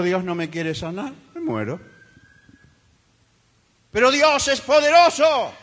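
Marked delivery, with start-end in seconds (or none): 0.0-1.8 faint dying voice. Retold or imitated. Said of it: "Le dijo".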